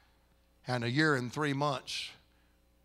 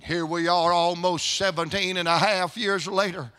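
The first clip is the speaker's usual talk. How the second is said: raised, loud testimony in a steady cadence; full-force preaching; 6 semitones higher, 7 dB louder than usual. Real, not acted. Preacher testifies of the family years later, loud fervent preaching. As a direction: proclaim it with preaching fervor.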